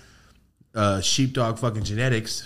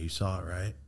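much softer, uneasy; hushed question; 3 semitones lower, 11 dB quieter than usual. Retold or imitated. Asking Matt quietly if he saw the blinds move. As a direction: ask it barely aloud, still shaken.

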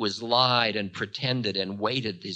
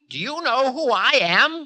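high, loud, affronted cry; indignant cry; 9 dB louder than usual. A narrator's reading. Time crying out at the Demon King, indignant. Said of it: "cried time".

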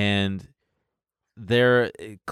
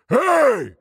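much higher and louder sudden bellow; retold yell; 6 dB louder than usual. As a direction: yell it big, to look bigger like an animal. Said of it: "And I just yelled".

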